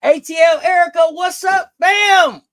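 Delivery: loud, high greeting shout; hype shout. Real, not acted. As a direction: call out the welcome with big energy. Hyping up arriving viewers.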